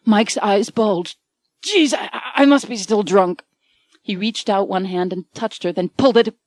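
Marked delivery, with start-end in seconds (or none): 1.6-3.4 startled. A narrator's reading. Mike sees woman in bed.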